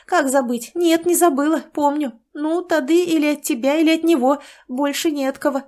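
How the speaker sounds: a hint of a chatty village woman; gossipy sister.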